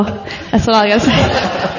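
slow, words melting into a laugh; amused, laughing over the line. Real, not acted. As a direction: tease him while giggling. Laughing at crying husband.

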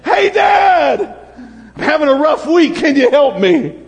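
put-on high, eager child calling Dad; lightly voiced eager child. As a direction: call out brightly to a father. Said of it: "we come like sons and daughters".

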